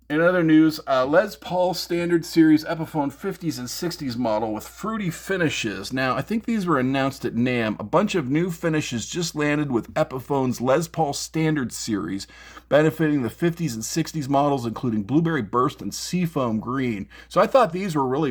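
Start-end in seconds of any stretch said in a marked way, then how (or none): none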